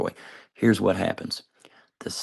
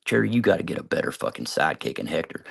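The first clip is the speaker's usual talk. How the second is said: ordinary delivery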